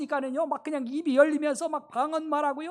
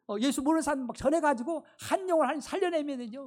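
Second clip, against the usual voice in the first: usual speaking voice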